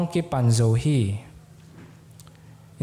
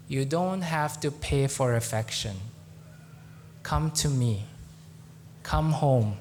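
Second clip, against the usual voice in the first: a hint of a loving father calling home; God's gentle voice; 5 semitones lower than usual. God speaking to Pete's heart, tender and low. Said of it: "I heard God's voice in my heart".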